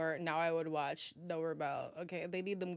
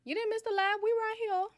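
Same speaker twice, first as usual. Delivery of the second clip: much higher and louder, bright and playful; sing-song call-out; 6 dB louder than usual. Real, not acted. Calling out to livestream viewers, high and sing-song. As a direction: call out to the chat like they just walked in.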